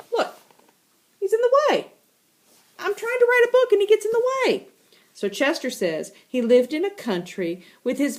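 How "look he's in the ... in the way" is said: much higher, fast and exasperated; high, hurried grumble about the cat; whiny complaint. Acted. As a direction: complain like a fed-up author. Voicing Melanie's complaint.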